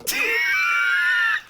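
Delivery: shrieking burst of laughter; loud high laugh. Real, not acted. Vlogger cracking up at own joke, shrieking laugh. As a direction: howl with laughter.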